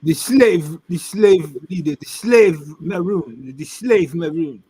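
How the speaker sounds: poor imitation of African accent. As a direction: imitate a man with a French-sounding African accent. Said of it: "my poor imitation of African".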